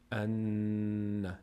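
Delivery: ان with a long hummed nasal n; stretched humming doubled n in ان; ghunnah. Shown as a demonstration. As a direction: hold the ن of ان through the nose.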